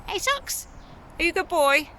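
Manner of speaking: high cooing call to the cat; sweet high call to coax him. Real, not acted. Mum calls sweetly up to Socks on the roof, high baby talk.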